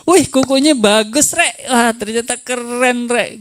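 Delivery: high-pitched mock amazement; retold wowed compliment, pitched well up; hammed-up admiring praise. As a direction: gush over it, playfully overdone. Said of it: "Gitu, pujilah".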